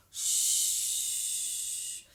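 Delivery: hissing wind noise; shushing wind sound. Acted. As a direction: blow it out like a cold wind. Imitating wind.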